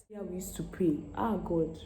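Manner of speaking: ordinary talk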